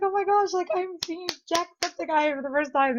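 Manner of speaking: high-pitched fan gush; starstruck. Retold or imitated. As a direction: gush in awe on meeting an idol. Retold starstruck reaction.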